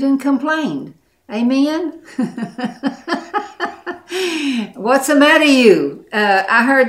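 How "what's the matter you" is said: put-on loud, high-pitched Italian family accent; Italian-American accent. Preacher mimics her arguing Italian family, loud Italian accent. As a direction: holler it in a playful Italian accent. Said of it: "an Italian family".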